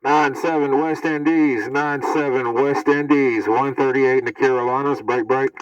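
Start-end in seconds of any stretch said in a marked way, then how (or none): none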